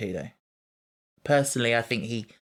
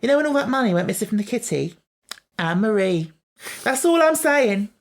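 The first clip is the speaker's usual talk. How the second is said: high, loud gossipy accusation; mock accusation; 10 semitones higher, 7 dB louder than usual. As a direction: drag the name out with a laugh. Acting out Donna's accusation.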